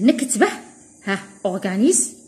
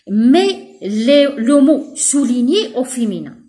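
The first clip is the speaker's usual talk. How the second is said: slow, louder reading of the instruction; teacher dictating slowly; slow dictation; 5 dB louder, slower than usual. Shown as a demonstration. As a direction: read the task aloud slowly. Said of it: "reads instruction slowly".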